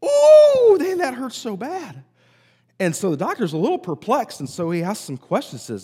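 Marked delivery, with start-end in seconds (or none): 0.0-2.0 very high, loud ooh, then slow whine; hammed-up howl of pain. Tom howls as the doctor presses again, hammed-up pain. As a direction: overplay the hurt for laughs.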